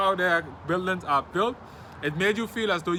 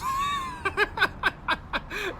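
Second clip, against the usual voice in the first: real high cackling laugh; high-pitched laughter. Real, not acted. Vlogger laughing over spending life savings on chicken, high laughter. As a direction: crack up helplessly at your own exaggeration. Cracks up over own exaggeration.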